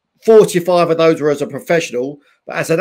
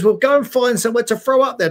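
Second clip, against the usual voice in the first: brisk, higher-pitched Irish dad; Irish accent; 5 semitones higher, faster than usual. Retold or imitated. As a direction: send the lad off briskly, in Irish. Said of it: "me dad's Irish".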